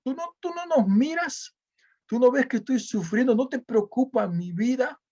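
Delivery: pitched high, a plaintive whine at God; mock complaint to God. Believer complaining to God, high plaintive whine. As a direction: whine it like a hurt believer accusing heaven. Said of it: "como quejándonos".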